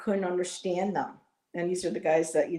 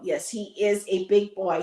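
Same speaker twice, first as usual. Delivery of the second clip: normal talking voice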